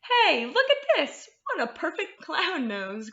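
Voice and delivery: high, laughing delight; a bear thrilled with his find. A narrator's reading. Bear finding the hat as a clown nose, laughing delight. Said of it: "cried the bear".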